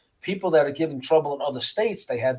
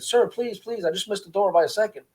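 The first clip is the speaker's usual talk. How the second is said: raised pitch, retold pleading; emphatic but polite plea; 6 semitones higher than usual. Prisoner late for rec begging the officer, emphatic but polite. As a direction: imitate a prisoner pleading respectfully. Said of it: "He was being emphatic but polite about it".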